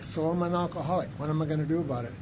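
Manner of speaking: thinking it over quietly; quiet realization. Retold or imitated. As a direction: let it sink in. Realization to himself.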